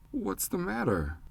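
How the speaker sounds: put-on a small boy's worried, high question; lightly voiced anxious child; apprehensive child. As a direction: ask it like a nervous little boy. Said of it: "Pete asked with apprehension".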